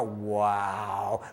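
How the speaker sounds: low, impressed wow; drawn-out wonder. Retold or imitated. To a crying child in the game, low wonder. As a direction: stretch it out in hushed amazement.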